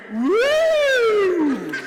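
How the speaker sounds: high, loud whooping cheer; cheer woo. Shown as a demonstration. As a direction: show them the big woo. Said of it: "we're gonna go".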